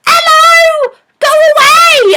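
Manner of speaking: very high, loud hammed-up cry; wife's mock yell. Wife shouting at the man who came for her, mock high yell. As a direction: shriek it as a caricature of the wife. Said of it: "the wife comes out, she's like".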